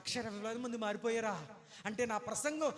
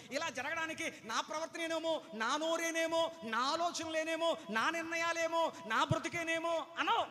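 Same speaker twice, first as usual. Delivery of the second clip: voiced inner doubt; 7 semitones higher, 3 dB louder than usual. Preacher voicing self-doubting believer.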